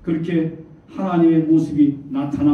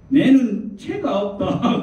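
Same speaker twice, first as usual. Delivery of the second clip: higher, drawn-out Gyeongsang dialect quote; 5 semitones higher, much slower than usual. Retold or imitated. God answering Gyeongsang believers, in their own dialect. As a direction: imitate God speaking slow Gyeongsang dialect. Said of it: "경상도 사람들에게는 경상도 사투리로".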